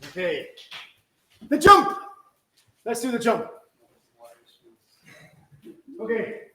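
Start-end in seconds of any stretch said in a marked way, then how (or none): 1.5-2.0 sudden loud, high call; short raised-voice command. Real, not acted. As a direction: announce it sharply to the whole class. Calls out the next move.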